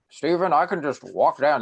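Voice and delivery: put-on high, loud old grandpa voice; grandfather voice. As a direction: play the skeptical old grandfather. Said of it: "this is my grandfather voice".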